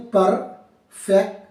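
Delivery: Perfection slowly split as par, fek, shan; syllable by syllable. Shown as a demonstration. Teacher showing students the three syllables in perfection, syllable by syllable. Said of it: "इसमें कितने syllable हैं".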